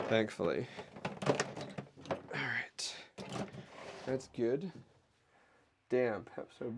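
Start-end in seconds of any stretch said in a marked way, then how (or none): 1.3-4.7 breathy whispered aside. Real, not acted. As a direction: whisper it to yourself.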